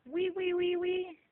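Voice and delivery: high, loud piggy wee; piggy squeal. Shown as a demonstration. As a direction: squeal like a little pig for a toddler. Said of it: "What does a piggy say?".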